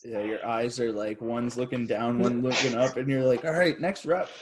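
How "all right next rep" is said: high-pitched mock readiness; imitated dazed player. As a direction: play a knocked-silly teammate gamely carrying on. Imitates dazed friend after the hit.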